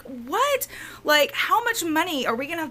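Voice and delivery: high-pitched incredulous reaction; retold disbelief. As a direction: replay your shock at bad news. Retells her reaction to repair news.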